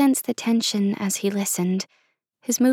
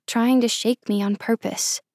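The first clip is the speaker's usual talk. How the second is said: ordinary delivery, nothing marked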